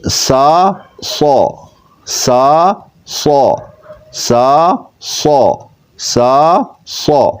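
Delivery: سا light and hissing, سو thick and full; thin s, heavy s. Shown as a demonstration. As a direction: alternate سا and heavy سو. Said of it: "صاد کو پر کر کے پڑھیں گے".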